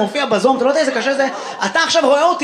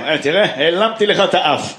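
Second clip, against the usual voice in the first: much lower uncle voice; imitating an uncle; 8 semitones lower than usual. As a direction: play the corny old uncle. Imitating an uncle's old joke.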